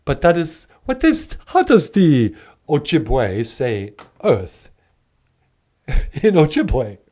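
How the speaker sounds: halting, swooping vampire inquiry; drawn-out question in a puppet-vampire accent; Count Von Count. Acted. Count asking the host for a word, theatrical vampire voice.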